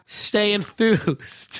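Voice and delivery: high, very slow cheesy sign-off. Pretend. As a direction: drag it out like a cheesy host. Mock upbeat sign-off.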